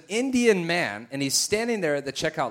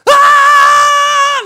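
very loud, high crying scream; imitated wail; 16 dB louder than usual. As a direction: mimic her losing it completely. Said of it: "flailing and crying uncontrollably".

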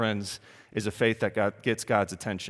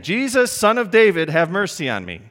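loud, high pleading call; crying out; 7 semitones higher, 8 dB louder than usual. Acted. Beggar calling to Jesus from the roadside, loud plea. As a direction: call out to him in desperation. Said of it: "And then again".

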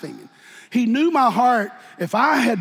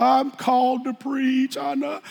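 slow, higher, a touch of a tearful confession; imitating a man crying; 6 semitones higher, slower than usual. Preacher imitating a brother crying his call. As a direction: mimic a brother's weepy testimony. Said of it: "And he started crying".

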